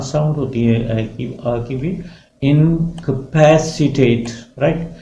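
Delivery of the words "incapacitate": Incapacitate drawn out very slowly, ending on a clean tate; careful pronunciation. Shown as a demonstration. Teacher repeating Incapacitate slowly for the class, very slow and careful. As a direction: stretch Incapacitate syllable by syllable. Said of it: "the word is tate".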